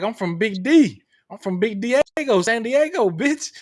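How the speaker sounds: mock swagger, pitch climbing to bitch; imitating the boast. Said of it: "and you were like".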